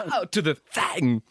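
Christopher Walken. Acted. Finishing a bad Walken on request, slow and odd. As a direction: stretch the words out with odd emphasis. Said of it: "Christopher Walken, oh, it's not very good either".

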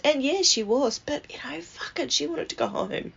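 real upset, pitch rising as volume drops; getting emotional. Real, not acted. Grandchild regretting Nan never went home, getting emotional. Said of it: "now I'm getting emotional".

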